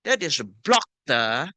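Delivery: ordinary delivery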